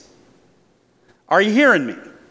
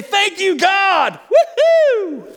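joyful thanks; 10 semitones higher, slower than usual. Pretend. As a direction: cheer it out like you just got money. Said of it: "it's easy to say".

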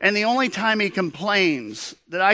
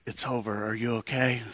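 hushed and gentle, much softer; soft nurse reassurance; 4 semitones lower, 8 dB quieter than usual. Retold or imitated. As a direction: ease him down softly, like a nurse after a shot. Nurse consoling the shaken dad.

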